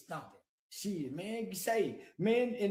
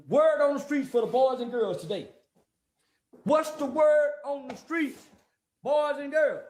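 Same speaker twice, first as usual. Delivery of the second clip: put-on kids' show host; 5 semitones higher, 7 dB louder than usual. Addresses boys and girls.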